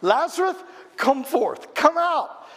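Lazarus called out high and loud; Jesus calling the dead man out with a great shout; shouted command. Acted. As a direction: shout it into the tomb. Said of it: "He shouted".

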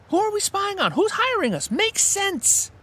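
high mock-incredulous questions; sarcastic.

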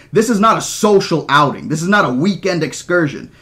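heated dressing-down; angry rebuke. Acted. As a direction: hammer each point home angrily. Angry quarrel, rebuking Hammond.

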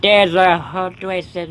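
slow, drawn-out copy of the villain's line; mimicking the game voice. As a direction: repeat the creepy line slowly and theatrically. Echoing the game character's line.

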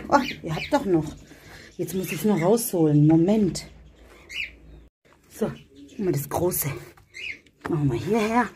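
ordinary talk

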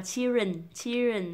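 put-on "children" as "chi-rin", no L or D; common wrong children. Shown as a demonstration. Teacher mimicking learners, "children" missing two sounds. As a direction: drop L and D from "children". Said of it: "Các bạn hay đọc là".

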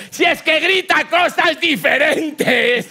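very high, loud, slow laughing shout; wife yelling at the cop through laughter; wife's mock shrill shout. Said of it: "le dijo al policía".

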